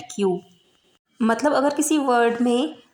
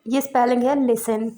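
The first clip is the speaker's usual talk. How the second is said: ordinary delivery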